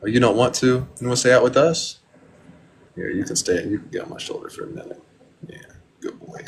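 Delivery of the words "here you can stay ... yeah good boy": soft quick whisper; soothing whisper to bird. Real, not acted. Owner inviting parakeet onto shoulder, soft whisper. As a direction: hush the bird gently.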